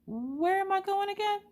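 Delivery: high-pitched, bewildered wondering aloud; comically puzzled self-questioning; confused. Retold or imitated. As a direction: act lost, voice way up high. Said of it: "I was so confused".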